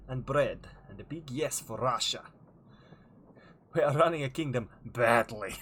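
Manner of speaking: mock Russian accent, emphatic. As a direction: chat in a hammed-up Russian accent. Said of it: "in a Russian accent".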